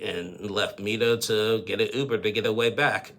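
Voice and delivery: usual speaking voice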